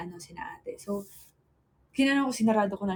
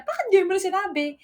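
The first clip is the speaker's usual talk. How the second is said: surprised quote; 10 semitones higher, 5 dB louder than usual. Retold or imitated. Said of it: "Tapos sabi niya".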